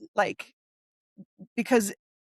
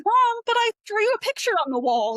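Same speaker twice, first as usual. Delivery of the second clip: put-on high, pleading little kid voice; child explaining to mom, high and innocent; 12 semitones higher, 4 dB louder than usual. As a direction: play a kid excusing himself to mom. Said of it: "a little kid being like".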